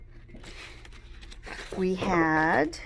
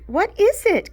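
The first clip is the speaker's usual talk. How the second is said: much higher, louder squeal; excited squeal; 12 semitones higher, 6 dB louder than usual. A narrator's reading. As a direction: squeal it, can't wait. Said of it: "Chloe squealed".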